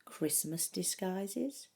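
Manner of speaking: ordinary delivery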